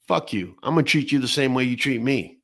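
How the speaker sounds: usual speaking voice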